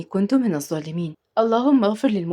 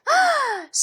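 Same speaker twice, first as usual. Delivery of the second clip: shocked gasp. Acted. Shocked on hearing the resort.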